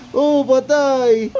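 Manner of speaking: ordinary delivery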